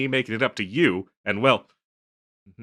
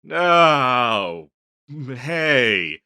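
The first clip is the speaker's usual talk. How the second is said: dismayed greeting; 4 semitones higher, 6 dB louder than usual. Acted. Caught unprepared by partner's arrival.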